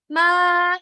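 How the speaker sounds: sustained "ma" held high; high pitch. Shown as a demonstration. Teacher showing three pitches, high held "ma". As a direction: sing out "ma" at a high level pitch. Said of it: "high pitch".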